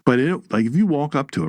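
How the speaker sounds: ordinary talk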